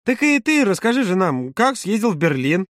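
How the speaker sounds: high, slow, drawn-out coaxing question; eager prodding. A narrator's reading. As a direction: coax the story out of him, high and slow. Маша eagerly prods Володя. (нетерпеливая просьба высоким голосом, врастяжку)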